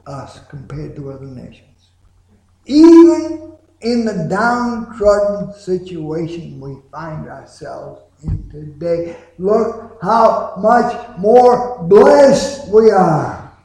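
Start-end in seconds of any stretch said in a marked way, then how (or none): 2.6-13.5 loud. Real, not acted. Preacher building to climax.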